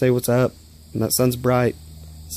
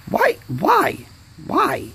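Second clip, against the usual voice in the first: pitched-up exasperated whys; repeated high-pitched why; much slower than usual. Real, not acted. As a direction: cry out why in disbelief. Angry at speeding car.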